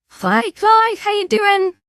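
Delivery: bright high greeting. Real, not acted. Greeting a viewer in chat.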